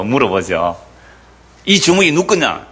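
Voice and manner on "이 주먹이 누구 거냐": a high-pitched mock scold from a grumpy old neighbor; old man scolding. Stingy old man scolds the boy who punched through his door, a high mock scold.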